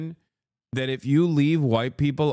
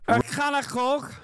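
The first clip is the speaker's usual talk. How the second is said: mock Scouse accent; 11 semitones higher than usual.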